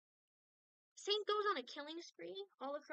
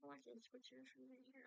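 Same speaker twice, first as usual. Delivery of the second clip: normal talking voice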